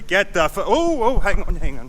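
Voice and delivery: high, loud startled stop; sudden catch. Real, not acted. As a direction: catch yourself mid-throw. Stops himself mid-throw.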